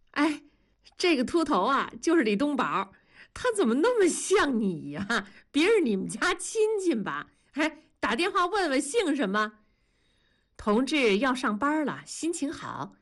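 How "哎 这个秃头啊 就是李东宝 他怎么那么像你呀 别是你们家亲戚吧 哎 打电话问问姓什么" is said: high, lively teasing wife voice; a wife laughing at her husband's lookalike on TV. Acted. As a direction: play the amused wife, poking fun. Wife teases husband about TV actor.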